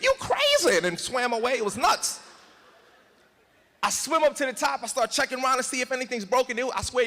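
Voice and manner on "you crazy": very high squeaky fish voice; talking fish. Retold or imitated. Fish underwater to the speaker, high squeaky remark. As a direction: pipe it up like a cartoon fish.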